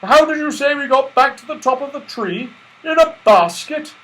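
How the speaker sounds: high, loud longing; eager Santa. A narrator's reading. Santa wanting to slide again, jolly eagerness. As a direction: beg for another go.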